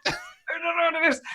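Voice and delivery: mock panic. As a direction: playfully panic to your companions. Acted panicked aside to companions.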